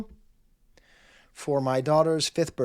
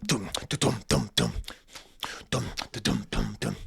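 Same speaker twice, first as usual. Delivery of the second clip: beatboxed rhythm; 6 semitones lower, 4 dB quieter than usual. Shown as a demonstration. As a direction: lower voice thumping out dum da dum. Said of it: "Neptune style rhythms".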